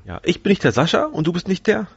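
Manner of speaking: ordinary talk, nothing marked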